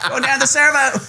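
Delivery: put-on high Australian accent through laughter; mock Aussie guy. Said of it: "What else they say?".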